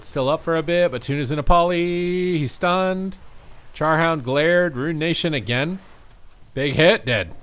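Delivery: high, loud call building to the kill; excited play-by-play. Real, not acted. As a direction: hype the final hit. Kill coming, caster escalates.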